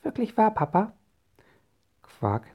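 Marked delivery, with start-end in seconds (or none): 0.0-0.9 high-pitched, questioning little voice; wondering child, pitched well up; child's voice. A narrator's reading. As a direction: ask Papa in a child's high voice. Child questions father in story.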